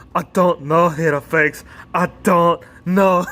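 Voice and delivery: announced attempt at a Scottish accent. Shown as a demonstration. Wrestling vlogger imitating Drew McIntyre for a laugh, Scottish accent. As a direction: put on your best Scottish voice, playfully. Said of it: "my best Scottish accent out".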